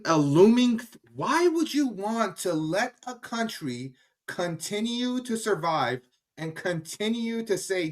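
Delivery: indignant rant to viewers; impassioned plea. Real, not acted. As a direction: press the point, each question rising. Impassioned plea against the regime.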